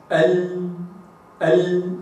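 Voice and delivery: isolated ال held with the tongue tip on the gums; sounding the Arabic lam. Shown as a demonstration. Teacher demonstrating the lam sound, ال said slow and steady. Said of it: "هكذا".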